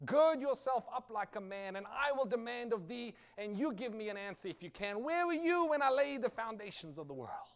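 loud, high, booming divine challenge; God thundering at Job. Acted. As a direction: thunder it down from heaven. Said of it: "All God says to Job is".

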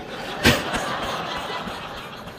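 breathy high laugh at own joke; real amused laugh. Real, not acted. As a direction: laugh out at the punchline.

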